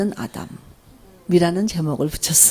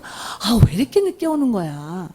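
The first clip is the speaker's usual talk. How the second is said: annoyed blurt. Retold or imitated. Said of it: "그럴 겨를 없이 그냥".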